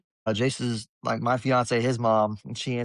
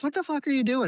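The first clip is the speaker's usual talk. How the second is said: startled dad; 14 semitones higher than usual. Retold or imitated. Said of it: "I'm like".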